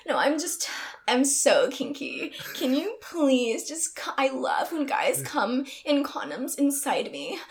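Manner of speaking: high, girlish put-on pleading; exaggerated high, coaxing plea; hammed-up kinky begging. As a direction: gush and beg in a high voice. Acts out kinky begging to client.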